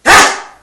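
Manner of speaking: sharp breathy sneeze burst; fake sneeze. Shown as a demonstration. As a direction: blast out a loud sneeze. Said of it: "kinda like".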